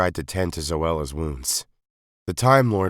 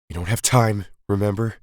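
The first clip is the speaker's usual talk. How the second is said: snapped; 5 semitones higher, 4 dB louder than usual. A narrator's reading. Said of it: "she snapped".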